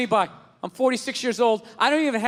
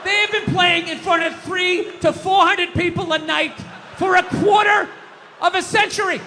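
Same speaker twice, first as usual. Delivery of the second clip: shouting, higher and louder; loud sharp voice; 5 semitones higher, 3 dB louder than usual. Real, not acted. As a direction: yell the rant at the crowd. Building rant about WNBA.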